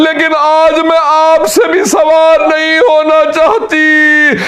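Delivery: high, slow, keening recitation; preacher wails the farewell scene high and slow; majlis lament. Acted. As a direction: let the voice climb into a drawn-out mourning wail. Climax of mourning recitation.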